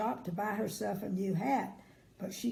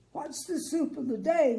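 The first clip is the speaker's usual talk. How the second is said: greedy man voice; 5 semitones higher, 5 dB louder than usual. Acted.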